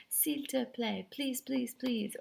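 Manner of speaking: a touch of sing-song begging, slowly on the French; super polite. Acted. Teacher showing students how polite French is, super polite pleading. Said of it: "being super polite and saying".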